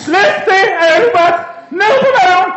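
high, loud shouting cop; police shout. Acted. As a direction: bark the raid order. Police raid orders.

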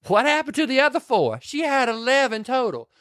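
high, exaggerated befuddled voice; mock confusion. Retelling [name] confused by six fingers, high mock bewilderment. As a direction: pitch it up, increasingly exasperated. Acted puzzled quote.

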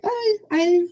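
light mock dismay at a missed clue; rueful realization. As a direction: catch yourself out, half laughing at it. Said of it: "I of all people feel like".

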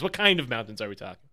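ordinary talk

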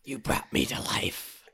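slow, whispered otherworldly bird; raven voice. Acted. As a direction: breathe it like an ancient raven spirit. S2 holds the Raven voice.

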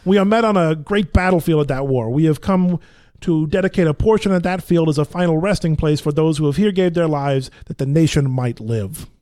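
loud Brooklyn recitation; Brooklyn accent. Shown as a demonstration. Actor test-reading the Gettysburg Address, Brooklyn accent. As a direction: proclaim it in an attempted Brooklyn accent. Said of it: "a Brooklyn accent".

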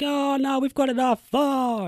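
high-pitched, whiny imitation of an executive; mock protest.

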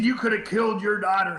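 high-pitched scolding reproach; acted-out outraged accusation; laying it on thick. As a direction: imitate a sheriff berating a father. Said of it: "he was just laying it on thick".

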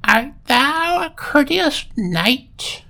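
high, slow, eerie spectral question; hollow ghost voice. A narrator's reading. Ghost confronts the prince, high and slow hollow voice. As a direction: draw it out like a phantom. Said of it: "she asked in a hollow voice".

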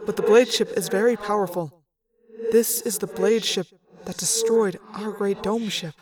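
echoing alien voice, measured and formal; composed alien officer. Acted. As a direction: report the danger gravely, without panic.

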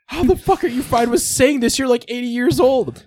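very high-pitched mock disbelief; incredulous outburst. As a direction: act out scandalized disbelief, voice way up. Acts out shocked reaction to old men.

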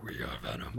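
deep, hushed monster introduction; very low villain voice; Venom. Retold or imitated. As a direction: announce yourself as a low, soft-voiced creature. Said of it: "And he's like".

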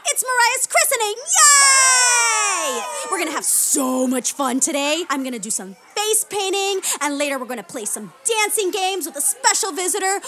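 put-on high, loud kids' party host; singsong puppet show host. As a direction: pump up the kids like a children's entertainer. Clown hosting kids' party.

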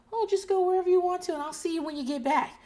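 high, breezy pretend parent; mock carefree parent. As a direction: wave the kid off in a high, quick voice. Voices an overly permissive parent.

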